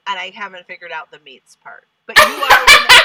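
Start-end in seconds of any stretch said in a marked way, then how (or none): 2.1-3.0 high, loud, laughing burst; laughing exclaim. Real, not acted. Cracking up while hyping the actress, high and loud. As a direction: blurt it out through a laugh. Laughing hype mid-pitch.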